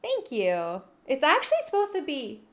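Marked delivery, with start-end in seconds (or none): none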